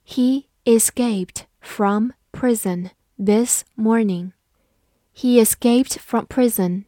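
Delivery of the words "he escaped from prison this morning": slow, deliberate sentence model; slow teacher read-aloud. Shown as a demonstration. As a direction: read it slowly word by word. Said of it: "好，慢读一遍".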